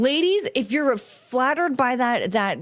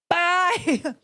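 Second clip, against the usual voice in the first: higher, hammed-up telling off; play-angry brush-off then a laugh; mock outrage; 6 semitones higher than usual. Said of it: "And I'm like".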